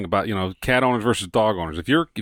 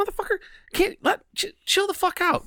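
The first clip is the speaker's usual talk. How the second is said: much higher cartoon voice of a fed-up dog; annoyed dog voice; 4 dB quieter than usual. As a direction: snap at the other dog like a cranky pup. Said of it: "It's like".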